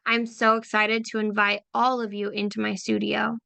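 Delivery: ordinary talk, nothing marked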